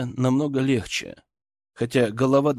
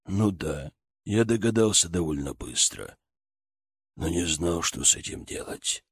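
low soft father; 7 semitones lower, 5 dB quieter than usual. Acted. Father voiced.

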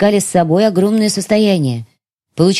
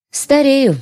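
sighing lament; 8 semitones higher than usual. Acted. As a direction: let Старею rise high then sink in a sigh. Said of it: "вздохнула я". (вздох на слове «Старею»)

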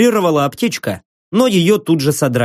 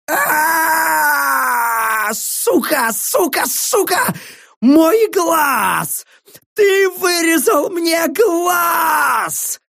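very high, long-drawn scream of pain; piercing agonised wail; 12 semitones higher, much slower than usual. A narrator's reading. Prisoner who has just lost an eye, shrieking. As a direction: wail it shrilly, stretching the last words. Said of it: "Пронзительно завопил пленник".

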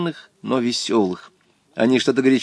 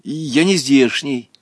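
ordinary delivery, nothing marked

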